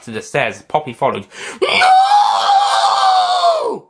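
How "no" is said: very high, loud creature shriek; alien cry. Acted. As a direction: scream out in alien agony. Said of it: "cried the Nestene".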